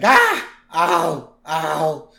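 mock cries of pain; yells of pain. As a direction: yelp like a cartoon workman hit in the legs. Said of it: "just yelling in pain".

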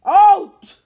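huge high roar. A narrator's reading. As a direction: roar it at the boys. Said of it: "roared Uncle Vernon".

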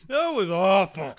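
loud, high, slow through laughter; real helpless laughing exclamation; laughing hard. Real, not acted. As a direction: cry it out while cracking up. Cracking up at a bad joke.

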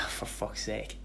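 breathy sighing whisper, nearly voiceless; whispered curse. Real, not acted. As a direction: let it slip out under your breath. Frustrated sigh while losing place.

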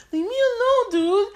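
high, exasperated leave me alone; shooing off a pest. Retold or imitated. Fed up with follower.

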